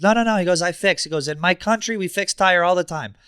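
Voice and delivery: insistent helper. Retold or imitated. Driver refuses to let him wait for the tow, insistent and high. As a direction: wave off his refusal eagerly.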